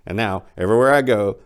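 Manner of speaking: ordinary delivery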